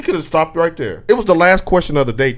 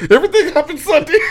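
laughing; 12 semitones higher than usual. Real, not acted. Laughing at Sunday.

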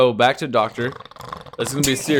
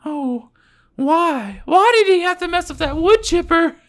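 high wailing woman's voice; girl voice; 5 dB louder than usual. Acted. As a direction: wail it as the grieving wife. Wife wailing in improv.

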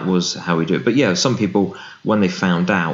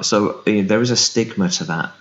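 usual speaking voice

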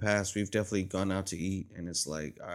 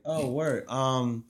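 higher, louder overly polite order; ordering at the drive-thru, a little higher and louder; polite drunk order; 5 semitones higher, 4 dB louder, slower than usual. Retold or imitated. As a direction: ask for it sweetly. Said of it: "I'm like".

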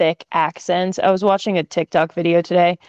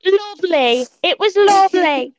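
high, mock British little kid voice; British child accent; 11 semitones higher than usual. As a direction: imitate a tiny British kid sounding like an old governor, much higher. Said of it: "little kids with thick, oh, thick British accents".

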